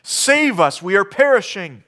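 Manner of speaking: high, loud cry for rescue; panicked plea. Retold or imitated. Disciples crying out in the storm, high and loud. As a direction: beg the Lord to save them. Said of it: "it says".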